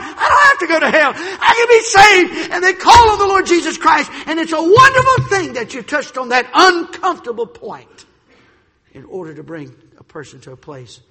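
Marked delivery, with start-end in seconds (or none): 0.0-2.5 crying out, high and loud with a sob; tearful joy. Acted. Saved sinner crying out in tearful relief. 2.5-8.0 shouted, much higher and louder preaching; full-force preaching. Acted. Preacher shouting about hell and salvation.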